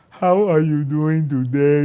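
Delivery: a hint of a prim, formal gentleman; mock the extra's courtly politeness; polite proper greeting. The extra greets him with a lightly voiced formal courtesy. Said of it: "He's like".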